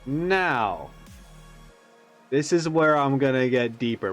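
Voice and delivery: ordinary talk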